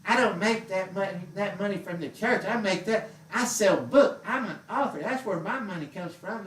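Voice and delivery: high, whiny-pitched mocking protest; mock defensive preachers. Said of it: "you know, they say".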